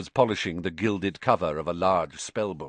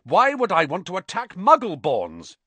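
indignant, high and louder; fierce; 10 semitones higher, 5 dB louder than usual. A narrator's reading. Harry challenging Ernie's accusation, fierce. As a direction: demand an answer in exasperation. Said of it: "said Harry fiercely".

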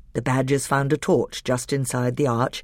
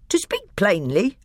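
higher, louder scornful dwarf; higher sneering dwarf; angry dwarf; 8 semitones higher, 4 dB louder than usual. A narrator's reading. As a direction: lay it out with contempt. Nick a Brick.

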